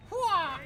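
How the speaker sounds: battle cry. Real, not acted. Throwing a strike in game.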